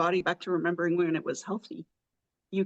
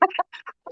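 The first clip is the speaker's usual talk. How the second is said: high burst of laughter; 12 semitones higher than usual. Real, not acted. Laughs at own story.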